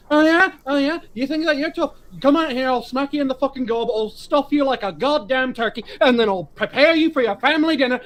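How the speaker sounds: hammed-up Irish traveller callout, fast and high; Irish traveller accent. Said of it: "very heavy Irish accent wrestling promos".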